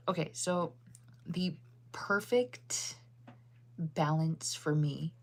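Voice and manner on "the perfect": stretched out mid-yawn. Real, not acted. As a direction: talk through a yawn. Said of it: "if I could stop yawning".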